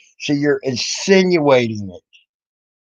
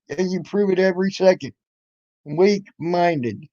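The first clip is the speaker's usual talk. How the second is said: normal talking voice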